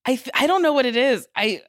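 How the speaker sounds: normal talking voice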